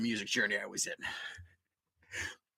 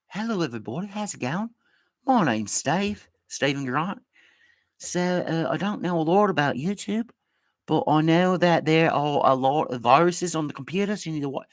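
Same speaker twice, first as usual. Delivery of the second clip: a light put-on British accent as Stephen Grant; 4 semitones higher than usual. Pretending to turn into Stephen Grant mid-show, light British accent. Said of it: "You're just doing an accent".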